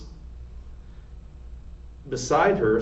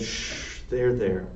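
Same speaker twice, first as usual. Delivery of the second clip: soothing; 4 dB quieter, slower than usual. A narrator's reading. Louise soothing Cook.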